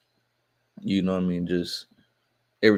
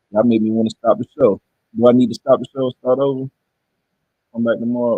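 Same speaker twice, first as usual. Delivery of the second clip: frustrated loud; 3 semitones higher, 9 dB louder than usual. Real, not acted. Frustrated by stream sound problems.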